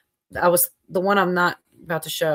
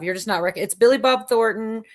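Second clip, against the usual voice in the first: ordinary talk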